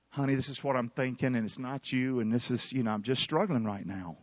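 soft, gentle words to a wife; tender confiding. Pretend. Husband gently confessing to his wife, soft and low. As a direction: ease it out softly, almost private.

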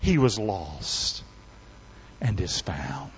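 slow, low and soft; quiet, deliberate close of the story; hushed, solemn ending. Acted. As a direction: let it sink almost to nothing. Solemn close of father's speech.

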